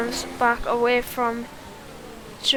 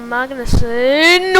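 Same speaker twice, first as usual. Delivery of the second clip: ordinary delivery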